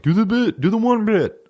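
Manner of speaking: high-pitched imitation of a pestering heckler; whiny-high mock audience demand. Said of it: "people come out and call out shit".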